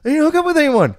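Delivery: very high, loud yelped denial; mock indignant protest. Son denies it to his mom, high indignant yell. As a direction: yell your innocence at the top of your pitch.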